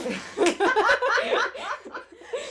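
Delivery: loud rising shriek of laughter; laughing at the friend being named; loud high laugh. Real, not acted. Laughing at name reveal.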